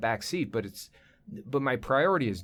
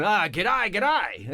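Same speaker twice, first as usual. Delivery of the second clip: higher-pitched, cheery Australian greeting; Australian accent; 6 semitones higher, much slower than usual. Acted. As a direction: greet the room as a put-on Australian. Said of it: "I'm doing the Australian thing".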